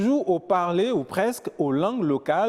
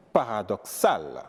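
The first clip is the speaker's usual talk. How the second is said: slow "paradoxal", last syllable stressed; accent sur la dernière syllabe; 7 semitones lower, slower than usual. Shown as a demonstration. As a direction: draw out the end of "paradoxal". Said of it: "l'accent tombe à la dernière syllabe". (« paradoxal » lent, accentué sur la finale)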